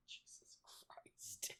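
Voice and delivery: breathy whispered Jesus Christ. Real, not acted. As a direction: whisper it under your breath. Aghast aside after the joke.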